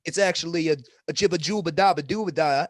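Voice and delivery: mock Italian accent. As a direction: imitate relatives rattling off pasta names in an Italian accent. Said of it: "how accurate that accent is".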